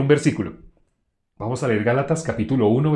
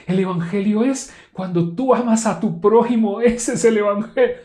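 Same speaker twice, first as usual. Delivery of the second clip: much higher, lilting pious tone; mock spiritual voice; 8 semitones higher than usual. Preacher mocking members' sweet answers, mock spiritual voice. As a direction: put on a holy-sounding churchy voice. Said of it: "un timbre de voz que suene como espiritual".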